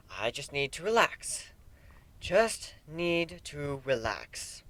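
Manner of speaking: soft, slowing self-soothing; breathing himself back to calm, very softly. A narrator's reading. Griffin talks himself down on the couch, softly and slowly. Calming himself after panic.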